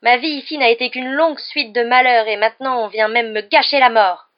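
loud, high, self-pitying wail; lamenting. A narrator's reading. Mimi complains of her miserable existence, wailing. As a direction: pour out the grievance at full voice. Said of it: "se lamenta Mimi".

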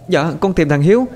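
put-on upset girl. Girl answers uncle, upset.

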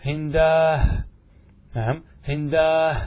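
هنداه drawn out slow and loud, ending in h; pausal ha on hindah. Shown as a demonstration. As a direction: stretch هنداه to its final h. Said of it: "بالهاء". (هنداه بالوقف على الهاء)

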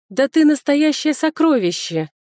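high, loud, slow burst of delight; heated exclamation. Acted. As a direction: exclaim it with warm excitement. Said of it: "горячо воскликнула я".

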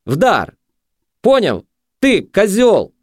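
high, sharp dare; high-pitched taunting cries; goading junkie. A narrator's reading. Junkie dares him to hit.

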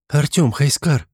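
slow, firm and commanding; resolute. A narrator's reading. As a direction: call them with resolve. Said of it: "решительно произнесла Дионисия".

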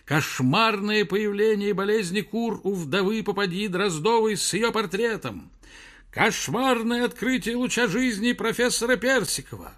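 high hawker's call; newsboy cry. Acted. Newsboys howling headlines in street. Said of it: "завывали неестественные сиплые голоса".

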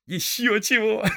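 high, loud retort breaking into laughter; happy laughing. A narrator's reading. Hobbit told he was feared dead, happy laughing. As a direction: laugh off the very idea. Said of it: "счастливо рассмеялся Сэм".

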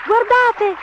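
put-on very high, loud children's cries; high-pitched kids calling out; excited shouts. A narrator's reading.

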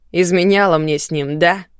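грубо. Acted. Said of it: "очередной грубостью".

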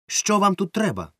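sharp, high, stern demand; stern caretaker challenging the boys; stern question. A narrator's reading. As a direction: snap it like a strict caretaker. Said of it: "суворо спитала тітонька".

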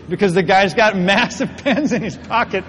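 ordinary talk, nothing marked

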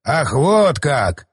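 drawn-out, slow indignation; slowly, taking offence; mock-outraged father. A narrator's reading. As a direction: stretch out the affronted words. Father scolds defiant daughter.

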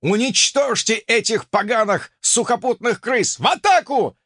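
rally the warriors to charge; a Viking roaring his men into the attack; battle cry. Acted. Viking rallies his troops.

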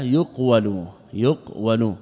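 "يقول" as yuq-walu, split at the q; underlying passive form. Shown as a demonstration. Teacher naming the form under study, "يقول" split as yuq-walu. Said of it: "وإنما البحث في ماذا؟".

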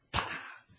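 short vocal "pah" for a lash; imitated whip crack.

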